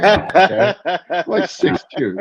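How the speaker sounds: real laughter breaking into the words; laughing. Real, not acted. A man laughs recounting his too-small bunny suit. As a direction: laugh while saying it, slowed.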